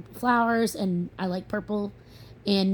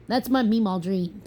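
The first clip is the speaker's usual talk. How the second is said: normal talking voice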